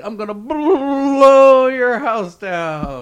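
big bad wolf. Big bad wolf threat joke.

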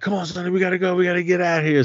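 high-pitched mock urging; imitated urgent plea. Gang pulling Sonny away from a fight, imitated urgent plea.

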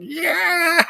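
squeaky high mascot "Yeah"; goofy mascot voice. Retold or imitated. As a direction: pitch it way up like a dopey mascot. Imitating the bear-suit guy's reply.

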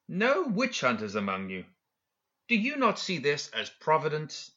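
lilting pitch, rising questions, mock-curious; courteous, probing speech with lifted questions; confident, needling professor. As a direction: play a self-assured schemer toying with officials. Professor's taunting speech in story.

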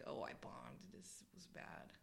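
whispered private thought. Pretend. Performer thinking to himself on stage after a bad set, whispered. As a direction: whisper it to yourself, voiceless and trailing away.

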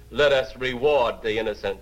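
ordinary talk

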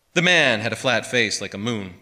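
plain reading voice

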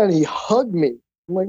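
normal talking voice

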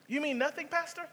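high, disbelieving question; mock disbelief from the congregation; incredulous listener. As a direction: play the stunned church member. Imitating a surprised listener.

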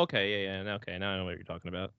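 ordinary talk, nothing marked

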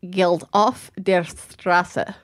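slow, careful German accent; amateur German accent on a film title; German accent attempt. Shown as a demonstration. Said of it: "my, like, attempt at a German accent".

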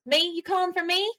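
eager cowgirl, startled to be called; perky Madril voice. Acted. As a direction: pop up surprised and bright. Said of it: "She goes".

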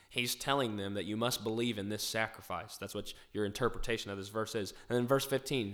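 ordinary talk, nothing marked

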